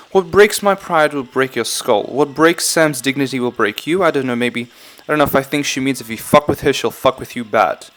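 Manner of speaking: amateur mock British accent; playfully faked British English. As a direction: read it in a put-on British accent. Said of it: "start doing this with the British accent".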